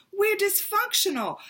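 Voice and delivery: thrilled declaration to her sister; excited. Retold or imitated. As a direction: announce it like wonderful news. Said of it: "I'm just so excited".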